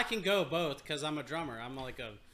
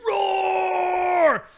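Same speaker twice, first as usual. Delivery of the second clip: much louder, higher straining roar; huge mock roar; 13 dB louder than usual. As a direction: go all out, a bigger roar than the first. Left hand squeeze, bigger roar.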